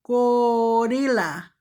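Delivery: Gorilla stretched out slowly, syllable by syllable; slow clear Gorilla for young learners; slow careful repeat. Shown as a demonstration. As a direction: model Gorilla slowly for the kids. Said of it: "slow repeat for learners".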